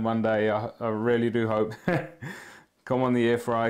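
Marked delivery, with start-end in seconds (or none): 1.8-2.4 short laugh. Real, not acted. Reacting to viewer comment.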